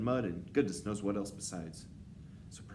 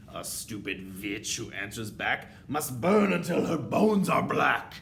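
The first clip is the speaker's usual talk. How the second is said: slow, loud witch chant, rising and swelling; menacing witch recital; screamed verdict; 3 semitones higher, 10 dB louder, slower than usual. A narrator's reading. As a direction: pronounce doom slowly, building to a scream. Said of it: "she screamed".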